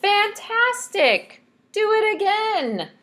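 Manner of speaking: excited shout. Acted. Princess cheers the tired dragon on, excited. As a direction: egg him on with a cheer. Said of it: "Elizabeth shouted".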